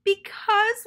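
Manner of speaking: very high, loud wailed because; hammed-up wail. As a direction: wail it like a frustrated child. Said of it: "wailed Toad".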